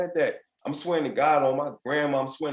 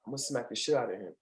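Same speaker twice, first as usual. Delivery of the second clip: muttered inner threat, much softer; inner thought; 7 dB quieter, faster than usual. Retold or imitated. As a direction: say it under your breath like a private thought. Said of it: "in my head I'm like".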